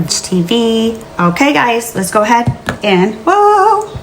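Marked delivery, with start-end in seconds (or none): 3.3-3.9 startled whoa. Real, not acted. Touches hot ornament, startled.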